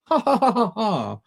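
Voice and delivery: high, staccato ha-ha-ha; mock laugh. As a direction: put on a laugh at your own joke. Mock laugh at his emptied room.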